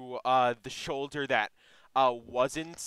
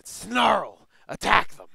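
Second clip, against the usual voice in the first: snarling bugbear; 4 semitones higher, 6 dB louder than usual. Acted. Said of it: "the bugbear says, like, grunts".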